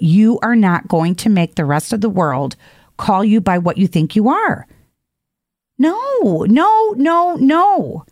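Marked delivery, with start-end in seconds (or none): 5.8-8.0 high, insistent repeated no; emphatic no. Real, not acted. Speaker refusing the litter box trend, high insistent no. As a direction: shake off the idea with rising insistence. Rejecting identifying as animals.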